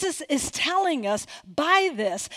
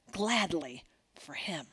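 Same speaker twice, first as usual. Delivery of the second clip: whispered; 8 semitones lower, 10 dB quieter than usual. Pretend. Tender close of gospel.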